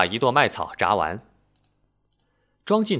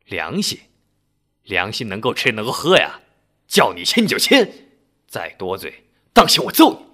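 roaring husband; 6 dB louder than usual. A narrator's reading. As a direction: bellow the threat at his wife. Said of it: "吼道".

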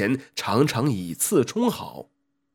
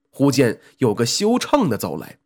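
usual speaking voice

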